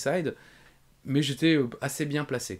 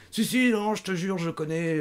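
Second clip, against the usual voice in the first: high, loud mock protesting; hammed-up pleading to be believed; imitated insistence; 9 semitones higher, 4 dB louder than usual. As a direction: insist as the over-eager promoter. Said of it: "il insistait".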